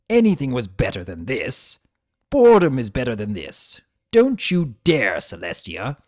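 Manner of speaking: rising outrage spat out; angry protest. Acted.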